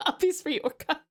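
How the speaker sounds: ordinary talk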